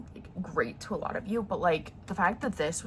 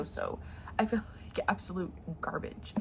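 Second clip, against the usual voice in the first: hushed, breathy whisper; 2 semitones lower, 6 dB quieter than usual. Real, not acted. Telling viewers she feels awful, in a whisper. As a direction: let it fall to a worn-out whisper. Feeling sick, throat hurting.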